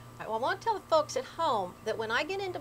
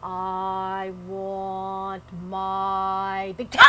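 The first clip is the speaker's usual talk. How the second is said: ghost voice; 2 semitones lower, 3 dB louder, much slower than usual. Acted. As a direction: drag it out, then swell at the end. Ghost voice, louder climax.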